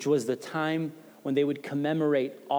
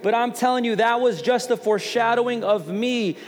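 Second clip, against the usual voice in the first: higher, louder, driving sermon voice; preaching at full force; 5 semitones higher, 4 dB louder than usual. Acted. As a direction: preach it hard to the congregation.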